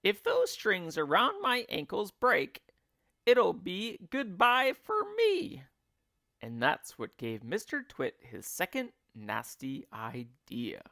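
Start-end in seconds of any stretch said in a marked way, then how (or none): 0.0-5.6 worried wife. Acted. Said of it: "she gasped".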